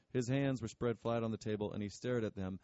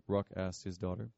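ordinary delivery

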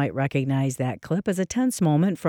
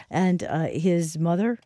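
usual speaking voice

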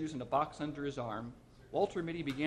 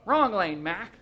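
louder, higher rebuke; gruff call; 6 semitones higher, 11 dB louder, slower than usual. A narrator's reading. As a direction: tell him off across the lot. Attendant scolding driver.

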